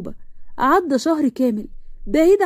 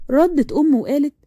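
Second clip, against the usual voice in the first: ordinary delivery, nothing marked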